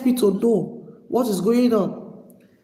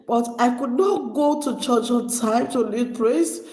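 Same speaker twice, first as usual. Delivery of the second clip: ordinary delivery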